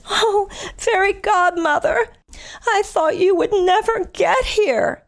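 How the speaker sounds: put-on high, slow girlish plea; Cinderella's voice. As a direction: sigh it out like a rescued princess. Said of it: "and she says".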